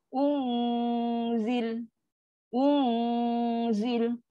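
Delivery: long nasal hum in "Un" before "zil" of "Unzil"; slow "Unzil", hum settled in the nose; held dengung. Shown as a demonstration. Teacher demonstrates the hum to students, held dengung. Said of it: "bunyi cara dengung dia".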